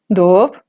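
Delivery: doop said with a rising pitch. Shown as a demonstration. Teacher repeating the example word, rising tone. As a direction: glide the vowel of doop upward. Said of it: "Otro ejemplo con tono ascendente".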